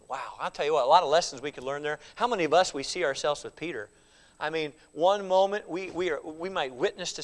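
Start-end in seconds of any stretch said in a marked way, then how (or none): none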